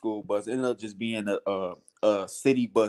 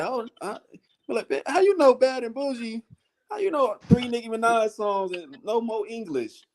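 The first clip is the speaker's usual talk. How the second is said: higher, puzzled mock voice; imitating a Thai woman; 11 semitones higher than usual. Thai woman who only knows rap songs, high mock confusion. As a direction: sound lost for words in English.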